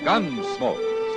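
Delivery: normal talking voice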